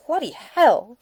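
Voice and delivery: loud, high "Bloody hell!" of shock; startled curse, loud; shocked outburst. Acted. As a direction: blurt it out, stunned.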